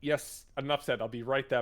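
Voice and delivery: brisk assent. Acted.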